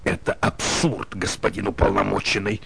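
breathy unvoiced whisper; tense courtroom whisper. A narrator's reading. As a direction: lean in and whisper it urgently. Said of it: "О'Фаррелл прошептал ему".

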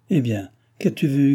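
usual speaking voice

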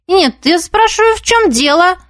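high, haughty demand. A narrator's reading. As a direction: look down your nose at her. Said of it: "надменно спросила".

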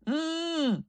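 wordless high whimper through closed lips; high muffled moan. A narrator's reading. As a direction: moan softly, pitched very high. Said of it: "тільки стогнав у відповідь".

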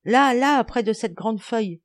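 high, loud, urgent reply; excited pointing-out. Acted. Boy showing the others the frog, excited. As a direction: point it out eagerly.

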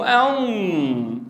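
"أم" stretched through the nose; a long nasal hum on "أم"; wrong nasal sound. Shown as a demonstration. Teacher contrasting the wrong nasal "أم" for students. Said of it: "مو".

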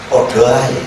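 ordinary talk

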